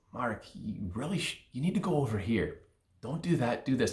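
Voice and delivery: the inner voice urging him quietly; insistent inner voice. Acted. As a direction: inner voice nagging him to change course, softly. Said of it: "And then it gets a little louder".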